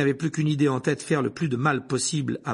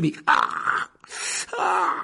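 sharp high cry of pain; 3 dB louder, much slower than usual. Acted. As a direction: yelp as the claws sink in. Harry attacked by the cat.

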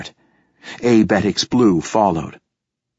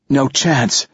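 higher-pitched, lightly acted gasp; a touch of breathlessness, pitched up; gasped reply; 5 semitones higher than usual. A narrator's reading. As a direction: answer as if short of air, understated. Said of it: "gasped Ania".

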